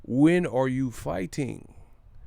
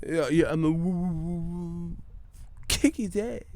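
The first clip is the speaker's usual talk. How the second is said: higher stammer fading, then a squeezed release; Harlem Nights stutterer; 4 semitones higher than usual. Retold or imitated. Replaying the movie dude with the stutter, strained stammer. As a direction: stick on "woo", then spit out the phrase. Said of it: "dude with the stutter".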